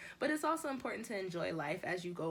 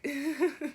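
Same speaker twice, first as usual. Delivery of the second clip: quick high, loud laugh; short laugh; 10 semitones higher, 9 dB louder than usual. Real, not acted. Laughing at herself for channeling [name]. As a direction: giggle it out.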